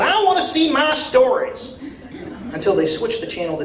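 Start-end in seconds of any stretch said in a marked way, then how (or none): 0.0-1.8 high, loud demanding yell with a laugh; a woman shouting for her soap opera. Acted. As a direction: holler it from the back of the room. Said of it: "keeps yelling".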